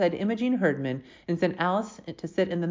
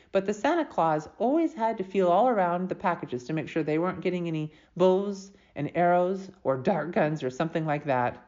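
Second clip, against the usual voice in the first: usual speaking voice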